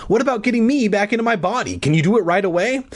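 high, pressing acted demand; impatient. A narrator's reading.

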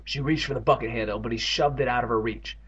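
usual speaking voice

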